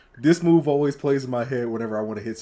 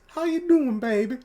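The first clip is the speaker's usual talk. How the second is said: high sweet grandma greeting; a lightly voiced grandma calling her grandson; 10 semitones higher than usual. Grandma greets grandson in the kitchen, high sweet voice.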